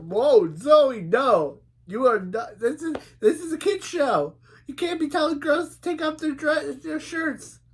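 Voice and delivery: high-pitched, loud protest; scandalized objection, voice shooting way up; shocked outburst. Real, not acted. Reviewer scolding Zoe over a kid's show line, shocked outburst.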